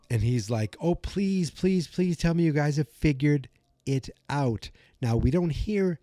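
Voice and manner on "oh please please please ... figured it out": high-pitched mock pleading. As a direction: act out begging for news, voice raised in pitch. Dustin begging friends for news.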